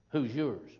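usual speaking voice

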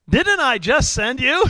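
high, slow rhetorical question; incredulous challenge. Acted. God challenging Gideon after his excuses, incredulous. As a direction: draw the question out in disbelief.

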